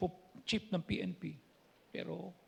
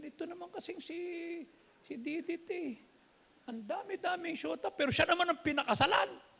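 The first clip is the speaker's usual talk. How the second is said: higher, fussy complaining woman; imitating Evelyn; 8 semitones higher than usual. Pastor imitates Evelyn teasing about the old girlfriends, higher voice. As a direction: mimic her teasing gripe. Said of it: "Sabi ni Evelyn".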